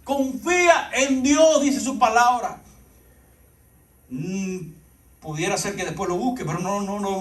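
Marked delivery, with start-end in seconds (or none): 0.0-2.6 high-pitched, driving sermon delivery; urgent exhortation to the congregation; impassioned preaching. Acted. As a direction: preach it with rising fervour, pitched well up. Preaches at full force.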